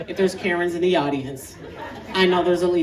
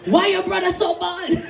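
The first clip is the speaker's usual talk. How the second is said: put-on loud, high Jamaican-accented bewilderment; exasperated Jamaican dad; Jamaican father; 7 semitones higher, 4 dB louder, slower than usual. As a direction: exclaim in a Jamaican accent, climbing higher. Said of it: "He'd be like".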